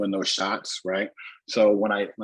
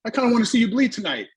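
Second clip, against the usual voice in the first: high, louder mimicked veteran; imitated wrestling veteran; 12 semitones higher, 5 dB louder, faster than usual. Veteran wrestler wants the newcomer to bleed, imitated high and loud. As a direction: needle the rookie in a put-on high voice. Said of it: "Abdul the Butcher looks at".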